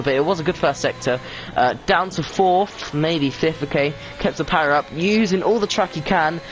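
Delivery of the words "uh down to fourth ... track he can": higher-pitched race call to the line; live play-by-play. Real, not acted. Commentator calls a driver over the line, live play-by-play. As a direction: carry the lap home to the finish.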